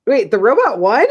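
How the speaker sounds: incredulous. Retold or imitated. Podcaster retelling her reaction to the episode's twist, incredulous. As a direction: react in startled disbelief. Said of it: "I was like".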